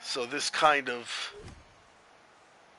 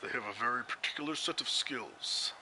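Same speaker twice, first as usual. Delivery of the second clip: movie tough-guy quote; 2 semitones lower, 8 dB quieter than usual. Acted. Movie line from Taken, imitated.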